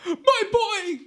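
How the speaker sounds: very high, loud overjoyed shout; joyful cry of a father. Retold or imitated. Blind father recognises his son, a high joyful cry.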